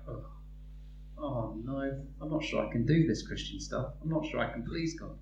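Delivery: lightly voiced worried believer.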